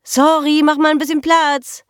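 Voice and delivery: high-pitched bellow over the din; loud yelling. Acted. Girl shouting to Ronny across the bar, yelling. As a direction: holler for the barman. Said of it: "brüllte sie lautstark".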